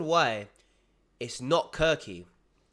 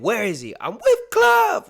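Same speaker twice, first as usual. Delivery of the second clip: loud, high mock yell; imitating shout; 10 semitones higher, 11 dB louder than usual. YouTuber repeating what's shouted in the clip, imitated yell. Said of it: "literally just repeating what's there".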